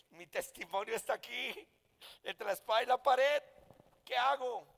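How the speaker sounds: retelling a moment of mock desperation; retold dilemma. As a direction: act out being cornered, voice climbing. Retells his own dilemma, pressed.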